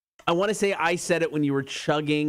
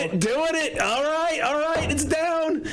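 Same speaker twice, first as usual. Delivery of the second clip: higher voice mimicking the clip; echoing the clip; 11 semitones higher than usual. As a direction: parrot the clip back, pitched up.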